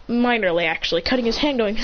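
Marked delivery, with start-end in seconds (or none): none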